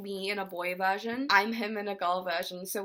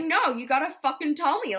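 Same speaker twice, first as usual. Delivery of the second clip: insistent demand; 5 semitones higher, 7 dB louder than usual. Retold or imitated. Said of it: "and I was like".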